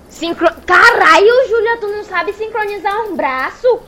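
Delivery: high, loud exasperated yell; furious shout. Acted. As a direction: explode in frustration. Losing patience with rowing.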